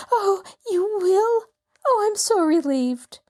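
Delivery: a weeping widow relieved the lawyer will help; tearful relief. A narrator's reading. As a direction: gasp it out through tears. Relieved after crying.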